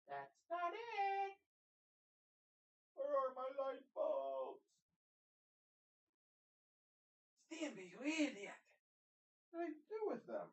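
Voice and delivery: very high, drawn-out falsetto whine; mock frustrated wail. Vlogger hunting for light bulbs in the closet, mock frustrated wail. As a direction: wail it in a squeaky, exaggerated voice.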